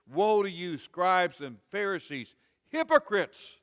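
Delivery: Jesus rebuking the Pharisees, loud and scathing; scathing rebuke. Retold or imitated. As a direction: thunder it at the scribes. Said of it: "he would say".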